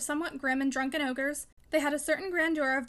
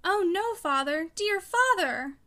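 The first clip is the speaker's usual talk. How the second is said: high, louder pleading cry, drawn out; choked with fear; 5 semitones higher, 5 dB louder, slower than usual. A narrator's reading. Daughter imploring her father, almost choked with fear. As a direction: beg him in rising dread. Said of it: "almost choked with fear".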